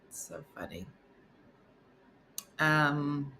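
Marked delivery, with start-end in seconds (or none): 0.1-0.9 whisper. Real, not acted. Whispered repeat of 124.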